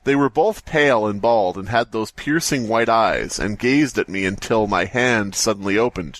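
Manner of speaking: usual speaking voice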